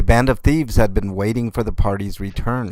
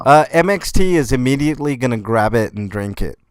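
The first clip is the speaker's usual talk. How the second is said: ordinary talk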